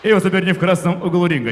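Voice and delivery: booming ring announcer intro; boxing ring announcer. Acted. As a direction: announce the fighter to the arena.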